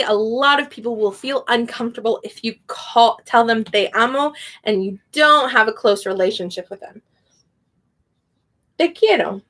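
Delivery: usual speaking voice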